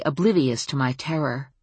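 usual speaking voice

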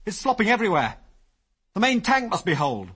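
high, loud urgent alarm; alarmed cry. A narrator's reading. Ginger warning Biggles the tank is holed, loud alarm. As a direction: raise the alarm, fast and frightened. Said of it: "he cried".

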